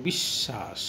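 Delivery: বিশ্বাস said very slowly with the শ doubled, bish-shash; doubled sound demo. Shown as a demonstration. Teacher shows students the doubled শ in বিশ্বাস, slow. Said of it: "দ্বিত্ব উচ্চারণ হয়".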